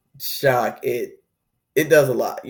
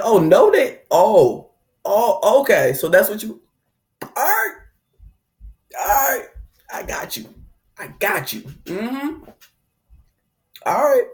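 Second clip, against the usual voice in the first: high, sarcastic mock anger; playful hammed-up anger; 7 semitones higher than usual. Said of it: "Then comes the anger".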